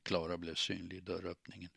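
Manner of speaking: ordinary delivery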